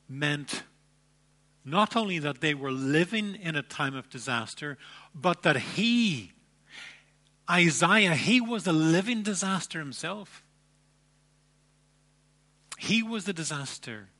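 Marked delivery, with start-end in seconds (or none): 5.1-14.1 "Isaiah" punched much louder; emphatic preaching. Real, not acted. Preacher stressing that Isaiah himself was the disaster, loud emphatic. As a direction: hit "Isaiah" hard, then drive on.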